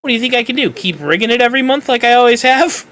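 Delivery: high, loud mock exasperated boss; hammed-up Brenda scoffing at him; imitating Brenda. Brenda mocking his question, hammed-up and exasperated. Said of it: "And she's like".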